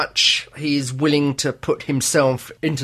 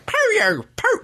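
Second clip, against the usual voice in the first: attempted Cockney. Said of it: "put the Cockney accent on".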